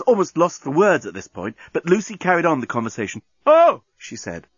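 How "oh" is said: high, loud moaned "oh"; passionate "oh". Acted. Lovers exchanging cries, passionate moan.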